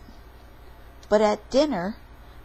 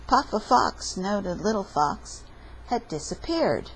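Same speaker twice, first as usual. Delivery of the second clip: ordinary delivery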